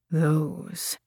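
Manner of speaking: soft, low, hurt undertone; whispered. A narrator's reading. Man pushing away from her, whispered. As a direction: let one word out, hushed. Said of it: "he whispered".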